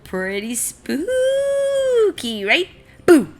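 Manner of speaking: high slow mock-spooky showoff; playful spooky. Seed showing off his squirrel costume, mock spooky. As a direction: stretch it out to scare playfully.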